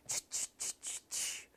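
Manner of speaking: whispered ch ch; voiceless chewing noise; chewing sound. Shown as a demonstration. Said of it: "ਛ ਦੇ ਵਿੱਚ ਦੀ ਆਵਾਜ਼". (ਫੁਸਫੁਸਾ ਕੇ ਚ ਚ)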